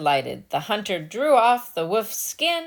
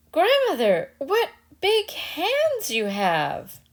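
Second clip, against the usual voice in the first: put-on high, slow childlike amazement; wondering girl; 10 semitones higher, slower than usual. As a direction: gasp it out, growing uneasy. Little Red Cap questioning.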